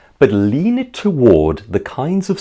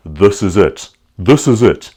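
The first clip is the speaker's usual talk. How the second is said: guttural South African "This is it"; South African accent; 4 dB louder than usual. Shown as a demonstration. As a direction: push "This is it" into a throaty South African sound. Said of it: "It was a more guttural sound".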